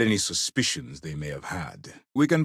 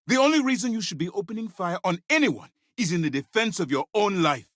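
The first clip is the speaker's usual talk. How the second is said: high, raised-voice reprimand; angry rebuke; 10 semitones higher than usual. Acted. Officer scolding an agent over opening fire, angry rebuke. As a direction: lay down the rule, pitched way up.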